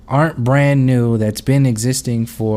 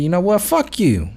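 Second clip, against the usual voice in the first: higher-pitched mock comeback; defiant retort; 7 semitones higher than usual. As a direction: voice her standing her ground. Acted defiant reply to condescension.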